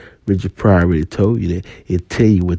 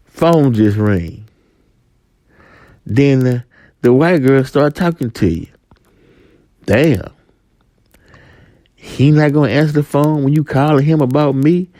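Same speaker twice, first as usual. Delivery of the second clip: ordinary talk, nothing marked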